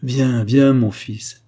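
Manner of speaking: loud, urgent summons; calling out. A narrator's reading. King summons his son to the litter, calling out. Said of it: "s'écria le roi".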